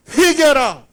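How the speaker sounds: loud, high proclamation; preacher's cry. Acted. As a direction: call out to the crowds like a desert prophet. Voices John preaching to crowds.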